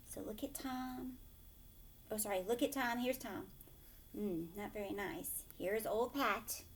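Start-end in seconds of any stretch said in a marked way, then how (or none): none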